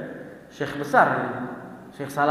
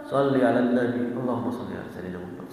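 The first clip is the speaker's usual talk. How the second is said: much lower, softer, fast soothing plea; calming blessing; 6 semitones lower, 4 dB quieter, faster than usual. Retold or imitated. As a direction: settle the quarrel with a hushed blessing. Calming the angry driver. (menenangkan dengan lirih)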